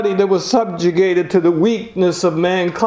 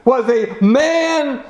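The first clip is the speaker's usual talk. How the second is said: raised, slowing sermon proclamation; preaching; 7 semitones higher than usual. Acted. Preacher proclaiming the cross to the congregation, rising fervour. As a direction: build it up like a preacher at the pulpit.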